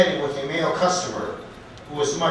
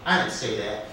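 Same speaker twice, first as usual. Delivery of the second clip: usual speaking voice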